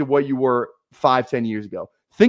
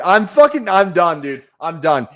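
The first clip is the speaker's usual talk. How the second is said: ordinary talk, nothing marked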